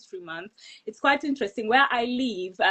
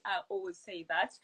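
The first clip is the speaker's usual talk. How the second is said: ordinary talk, nothing marked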